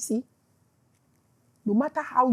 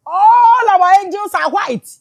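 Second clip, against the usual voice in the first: indignant declamation; 7 semitones higher, 7 dB louder than usual. Real, not acted. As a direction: stretch it out loud to the whole congregation. Indignant preacher declaiming a bitter claim.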